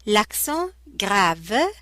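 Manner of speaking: "L'accent grave" said slowly, each syllable clear; slow textbook pronunciation. Shown as a demonstration. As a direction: spell out "L'accent grave" like a teacher. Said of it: "pronunciation lesson demo".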